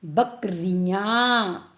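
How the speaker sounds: slow, syllable by syllable; drawn-out word. Shown as a demonstration. Teacher spelling the word slowly for pupils. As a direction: stretch the word out for the class. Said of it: "reading word slowly for class".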